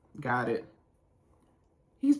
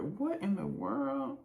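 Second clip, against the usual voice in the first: high, soft, slow stretched disbelief; incredulous; 10 semitones higher, 9 dB quieter, slower than usual. Real, not acted. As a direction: marvel quietly at the mess. Reacts to her own lashes.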